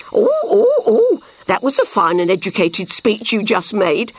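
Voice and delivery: high monkey hoots into chatty praise; playful monkey character voice; hooting monkey. A narrator's reading. Narrator voices Monkey hooting at Fox.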